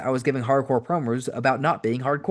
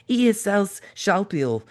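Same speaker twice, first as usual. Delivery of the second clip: German nurse; 8 semitones higher, slower than usual. Retold or imitated.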